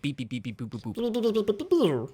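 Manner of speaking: droid beeping, last boops high and slow; imitating robot bleeps; R2-D2 beeps. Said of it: "It went something like".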